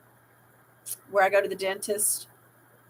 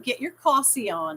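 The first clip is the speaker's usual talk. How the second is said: ordinary talk, nothing marked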